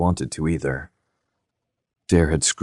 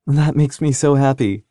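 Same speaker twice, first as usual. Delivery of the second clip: higher, happy and smiling; 8 semitones higher, 4 dB louder than usual. Acted. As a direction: beam through the words. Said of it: "Dare heard the smile in her voice".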